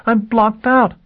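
high desperate plea; despairing cry. Acted. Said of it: "she cried in despair".

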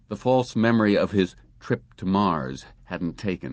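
plain reading voice

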